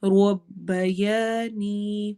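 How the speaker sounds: ربیانی with the alif stretched very long; long madd. Shown as a demonstration. Teacher demonstrates the madd for her class, very slowly. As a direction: hold the alif in ربیانی two counts. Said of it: "الف مدہ".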